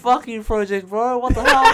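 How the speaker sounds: high, loud, indignant shouting; mock outrage. Real, not acted. Co-host left out of friend's twenty-first, loud mock outrage. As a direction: yell it like a scandalised mate.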